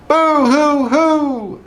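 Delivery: very high, slow hammed-up boo hoo; mock sobbing. A narrator's reading. Dogs in the pond, cartoon sobbing. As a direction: wail like scared puppies. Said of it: "sobbed the dogs".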